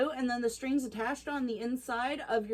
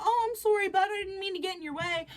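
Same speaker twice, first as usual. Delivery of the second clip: polite Canadian apology; 8 semitones higher, faster than usual. Acted.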